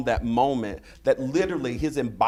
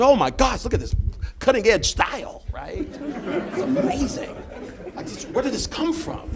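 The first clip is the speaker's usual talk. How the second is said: mock gushing; 13 semitones higher than usual. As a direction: gush like it's a brand new discovery.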